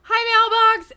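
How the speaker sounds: loud, high squealed hello; high, bursting hi to a friend; excited greeting. Real, not acted. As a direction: shout a delighted welcome. Streamer calls greeting to arriving viewer.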